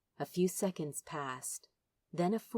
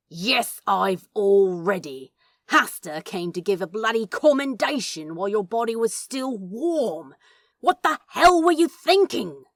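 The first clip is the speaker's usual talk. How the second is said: louder and louder, ending high in a yell; Crowley rages at the angel, building to a scream; furious shout; 3 semitones higher, 10 dB louder than usual. Acted. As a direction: boil over into a howl. Crowley confronts angel about Hastur.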